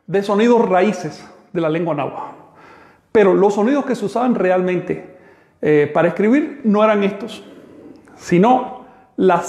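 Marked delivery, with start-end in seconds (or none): none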